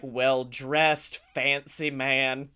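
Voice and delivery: ordinary talk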